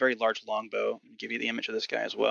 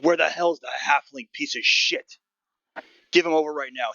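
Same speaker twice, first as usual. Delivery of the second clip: angry guard demand; 4 semitones higher, 4 dB louder than usual. Acted. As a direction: bark it at the captain blocking the ramp.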